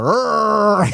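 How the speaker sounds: loud, high held "errr"; exaggerated roaring R; tiger growl R. Shown as a demonstration. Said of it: "like a tiger".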